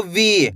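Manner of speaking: "वी" held long, falling at the end; drawn-out long ee. Shown as a demonstration. Teacher gives learners an example, stretched "वी". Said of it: "खींच के पढ़ोगे".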